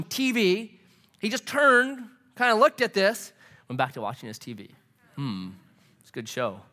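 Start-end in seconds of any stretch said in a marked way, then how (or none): 5.1-6.6 low, soft, mock-indifferent murmur; unbothered. Roommate glancing back at his TV, unbothered. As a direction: play the roommate who barely cares, low and quiet.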